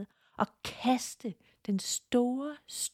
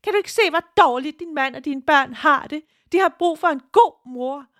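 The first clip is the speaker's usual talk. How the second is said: much higher, louder and faster, a frantic shriek; wild screaming demon; 9 semitones higher, 7 dB louder, faster than usual. Acted. The mother-demon shrieks at Tina as it breaks free. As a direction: scream it in a hysterical panic. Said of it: "Den skreg i vildens sky".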